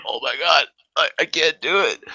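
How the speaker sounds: high, loud mock struggle; imitated drunk distress. Drunk friend gives up on push-ups, mock anguished whine. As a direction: play a drunk giving up mid-exercise.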